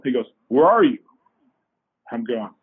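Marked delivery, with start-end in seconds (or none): 0.5-1.0 retold loud, abrupt phone demand; demanding client. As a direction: imitate an impatient client checking up.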